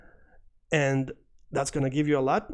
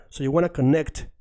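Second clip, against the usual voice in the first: ordinary talk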